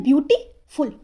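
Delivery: Beautiful with a pause before फुल; deliberate split in beautiful; broken into syllables. Shown as a demonstration. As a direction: say beautiful as two separate beats. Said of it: "हम बोल कैसे रहे हैं".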